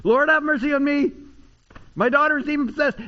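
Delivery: high, loud pleading cry; imitated yelling. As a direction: call out loudly like a persistent heckler begging for mercy.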